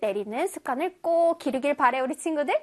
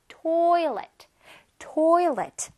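"toilet" with TOI long and strong; stress on TOI; much slower than usual. Shown as a demonstration. Teacher shows children the stress, TOI stressed. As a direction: punch TOI in "toilet", drop off on let. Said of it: "T O I의 강세가 들어가요".

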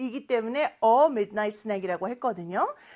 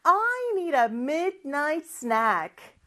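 higher, slow model sentence; drawn-out English, lifted pitch; slow repeat drill; 4 semitones higher, much slower than usual. Shown as a demonstration. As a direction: lead the class through it slowly. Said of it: "다시 한번".

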